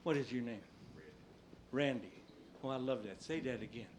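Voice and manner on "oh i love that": normal talking voice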